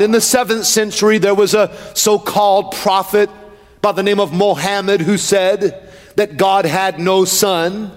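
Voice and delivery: emphatic pulpit delivery; full-force preaching. Real, not acted. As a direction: preach it steadily in measured, rhythmic phrases.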